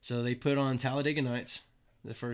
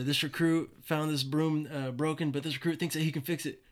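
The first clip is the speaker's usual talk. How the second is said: lightly imitated recruit reporting, higher in pitch; recruit talk; 5 semitones higher, 4 dB louder than usual. Recruit asking the drill instructor about a broom, mildly mimicked formal report. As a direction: recite it like a recruit requesting permission. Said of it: "we all have to talk a certain way".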